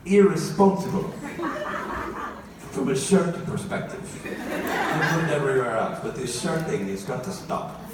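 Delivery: hammed-up Italian mother giving advice; exasperated mock-Italian mama; bad Italian accent. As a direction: scold and lecture in a comic Italian accent. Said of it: "My mom always talks in a bad Italian accent".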